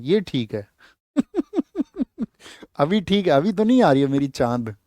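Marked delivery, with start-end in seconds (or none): 1.2-2.2 a high burst of laughter; sudden high laugh at a cheeky joke; real laugh. Real, not acted. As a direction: laugh it off, amused. Students joke about teacher's bald patch.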